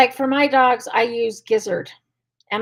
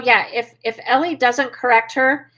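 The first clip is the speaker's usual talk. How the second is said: ordinary delivery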